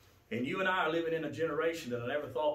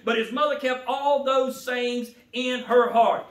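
loud, high fervent preaching; preaching at full force; 11 semitones higher, 10 dB louder than usual. Acted. Preacher urging the congregation to return to God, loud. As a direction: drive each line home to the pews.

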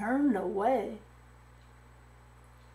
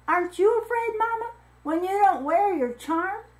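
put-on child's voice; 9 semitones higher, 5 dB louder than usual. A narrator's reading. Child asks mother about charm.

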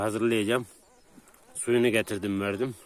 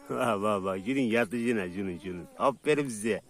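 much softer, tender murmur; cooing; 7 dB quieter than usual. Real, not acted. Shepherd softly cooing to the puppies. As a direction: coo to the pups.

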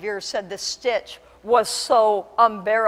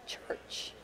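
whispered, barely voiced; hushed reveal; 16 dB quieter than usual. Shown as a demonstration. Preacher revealing where the lost are, hushed whisper. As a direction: drop to a near-silent whisper.

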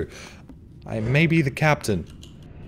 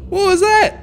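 very high, loud startled question; 8 dB louder than usual. Real, not acted. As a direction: gasp out the question. Startled by a noise.

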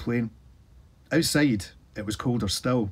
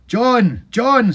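loud calls, much higher; shouting; 12 semitones higher, 10 dB louder than usual. A narrator's reading. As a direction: call out across the fog. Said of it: "Sid shouted".

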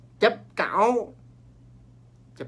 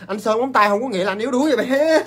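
playful teasing; 8 semitones higher, 10 dB louder than usual. Real, not acted. Teasing viewer in loud banter.

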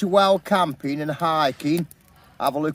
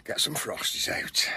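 breathy whisper; 5 semitones lower, 9 dB quieter than usual. Real, not acted. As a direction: whisper so nobody wakes. Whispering in the morning.

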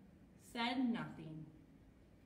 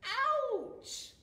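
high, loud acted yelp; pained cry; 14 semitones higher, 8 dB louder than usual. A narrator's reading. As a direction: cry out as if hit by a snowball. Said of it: "cried Fluff".

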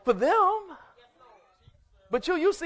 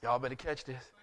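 much lower and softer; dropped low aside; 13 semitones lower, 11 dB quieter than usual. Real, not acted. Preacher leaning into the church, hushed and low. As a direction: pull them in close with it. Quiet repeat of refrain.

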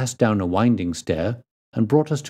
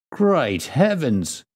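slow, high exclamation of astonishment; astonished cry; 6 semitones higher, much slower than usual. A narrator's reading. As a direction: draw it out, pitched up in shock. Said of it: "cried the inspector".

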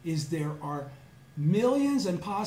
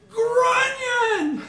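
very high, loud joyful yell; excited shout; 10 dB louder than usual. Retold or imitated. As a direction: shout it out as you leap up. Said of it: "I just jump up and I go".

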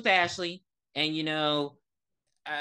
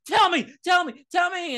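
loud, high frantic demand; freaking out; 12 semitones higher, 6 dB louder than usual. Retold or imitated. Podcaster imitating the boy demanding an answer, mock freak-out shouting. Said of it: "he starts that freaking out shit again".

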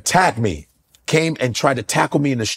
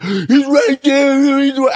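whiny accusation; 11 semitones higher, 4 dB louder, much slower than usual. Retold or imitated. Beaten guy accusing to police.

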